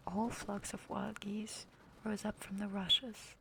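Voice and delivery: plain reading voice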